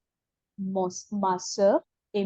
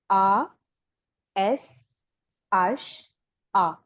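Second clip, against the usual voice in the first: slow, letter by letter, each one crisp; clear slow spelling; 2 semitones lower, much slower than usual. Shown as a demonstration. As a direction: spell it out slowly for a learner. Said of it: "your sound should be very clear".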